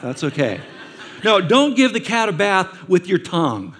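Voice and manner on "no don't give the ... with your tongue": mock scolding. Parent correcting the child, loud.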